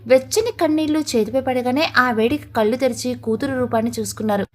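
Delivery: ordinary delivery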